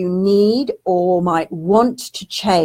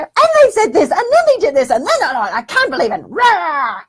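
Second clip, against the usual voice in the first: high, louder hammed-up ranting; mock frustrated rant; 9 semitones higher, 4 dB louder than usual. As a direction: vent it all out in a playful burst. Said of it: "and I'll just go".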